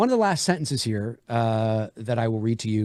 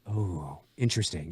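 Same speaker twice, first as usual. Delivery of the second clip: intrigued; 7 semitones lower, 8 dB quieter than usual. Shown as a demonstration. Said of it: "just something like".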